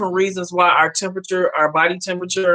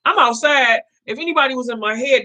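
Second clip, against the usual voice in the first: ordinary talk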